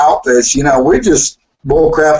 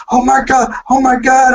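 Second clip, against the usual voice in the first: 1 mock fan gushing; 9 semitones higher than usual. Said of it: "these guys are like".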